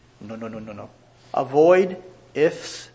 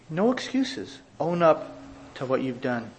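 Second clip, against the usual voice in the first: normal talking voice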